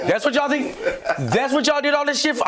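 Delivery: high, laughing taunts; mock the cops gleefully; talking shit. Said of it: "I'm talking shit now".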